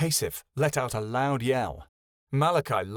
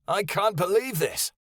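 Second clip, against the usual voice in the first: higher, astonished exclamation; amazed; 6 semitones higher than usual. A narrator's reading. Kyoto amazed at the Great One in astonishment. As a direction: gasp it out in disbelief. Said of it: "Kyoto exclaimed".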